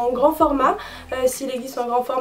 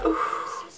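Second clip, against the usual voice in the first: soft, airy puff of dismay; retold dismayed "ouf"; 10 dB quieter than usual. Vlogger recalling her first sight of the dark tanner, dismayed exhale. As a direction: blow out a low-volume "ouf", re-enacting the reaction. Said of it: "j'étais là".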